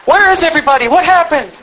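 high, loud mock-bewildered bystander; mock panic. Preacher mocking raptured bystanders' panic, high and loud. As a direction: play a confused person after the rapture, mockingly.

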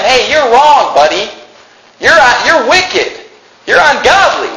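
high, punched preacher's rebuke; stern reproof. Acted. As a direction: call out the sinner to his face. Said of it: "Reprove them. Tell them".

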